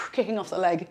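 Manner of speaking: normal talking voice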